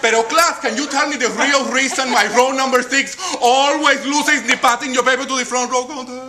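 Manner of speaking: put-on high Spanish-accented teacher running a contest; Mrs. Martinez the teacher.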